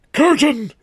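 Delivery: very high, pained squeak of a cry; tiny, falsetto moan that still carries. A narrator's reading. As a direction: squeak it out loudly, as if clutching your kidneys. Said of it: "the rotter moaned in a tiny voice".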